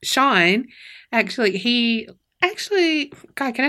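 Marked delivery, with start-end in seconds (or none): 2.4-3.1 high pitch. Real, not acted. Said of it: "I don't know why I had to go up so high".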